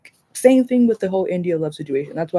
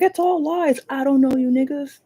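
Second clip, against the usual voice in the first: mocking denial; 8 semitones higher than usual. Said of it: "still be like".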